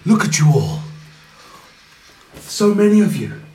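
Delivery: scornful villain. Acted. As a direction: belittle the boy wizard, then go cold. Villain mocks party.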